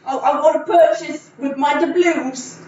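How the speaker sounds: hammed-up peasant voice; mock British peasant. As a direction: grandly ask to pay in doubloons. Improvised Renaissance fair scene.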